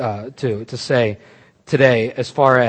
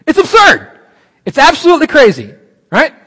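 much higher and louder, exasperated; exasperated exclamation; 11 semitones higher, 8 dB louder than usual. Real, not acted. Preacher telling the congregation the lie is crazy, loud and exasperated. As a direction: throw up your hands at the absurdity.

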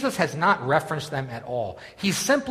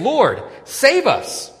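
high, loud plea for rescue; desperate cry; 10 semitones higher, 8 dB louder, slower than usual. Retold or imitated. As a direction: call out as if the boat is sinking. Disciples cry out in storm.

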